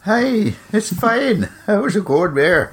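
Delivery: put-on a high, playful mix of Irish and Scottish accent, slowing at the end; mock Celtic accent, half Irish, half Scottish. Host answers his cohost in a jokey half Irish, half Scottish accent. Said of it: "I sounded half Irish, half Scottish".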